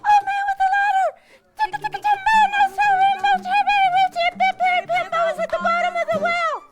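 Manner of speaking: put-on very high, loud, rapid-fire child's yell; desperate shout. A narrator's reading. Little boy using his last breath to rouse the old man, shouting. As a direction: shout it all at top speed. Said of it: "he shouted".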